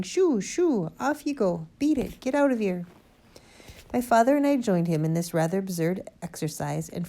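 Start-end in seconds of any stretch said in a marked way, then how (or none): 0.0-2.8 high, raised shooing calls; shouting. A narrator's reading. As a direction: shout to drive the birds off. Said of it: "waving his arms at the pheasants and shouting".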